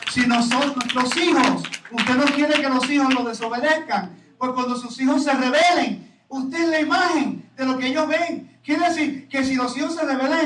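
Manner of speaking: loud, driving pulpit voice; loud fervent sermon delivery; full-force preaching. Acted. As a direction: preach it hard to the congregation.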